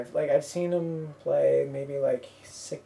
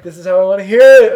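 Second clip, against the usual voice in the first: loud, quick, laughing self-imitation; loud mock insistence; 4 semitones higher, 18 dB louder, faster than usual. Fan explaining her loud harmony to the singer, loud mock insistence. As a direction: belt it out through laughter.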